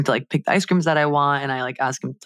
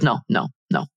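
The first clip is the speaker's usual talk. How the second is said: lower, dismissive no, no, no; imitating his curt no; 6 semitones lower than usual. Mimicking the vendor's dismissive reply to her. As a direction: brush it off, mimicking him. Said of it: "Like this".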